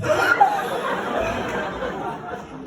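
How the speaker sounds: huge high gasp; long, theatrical intake of breath; shocked gasp. Acted. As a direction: gasp in mock horror. Son shocked by mom's confession.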